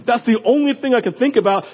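usual speaking voice

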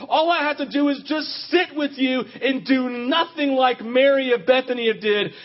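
ordinary talk, nothing marked

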